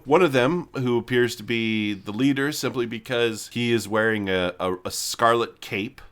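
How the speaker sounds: ordinary talk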